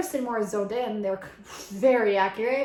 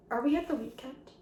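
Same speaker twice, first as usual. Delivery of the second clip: ordinary talk, nothing marked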